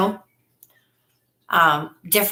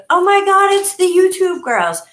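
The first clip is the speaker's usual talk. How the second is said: much higher and louder, starstruck gasp; excited recognition; 12 semitones higher, 5 dB louder than usual. Retold or imitated. Passersby at the beach spotting them, high excited squeal. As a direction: squeal like a fan spotting celebrities. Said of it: "every person that we walked by was like".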